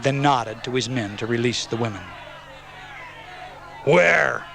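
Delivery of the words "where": one loud, raised bellowed word. A narrator's reading. Wolf demanding of Stephanie, bellowing. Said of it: "Wolf bellowed".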